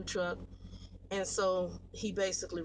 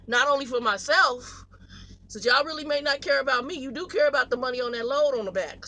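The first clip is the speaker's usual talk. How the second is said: ranting louder; 4 semitones higher, 8 dB louder than usual. Real, not acted. Rants at companies about parking.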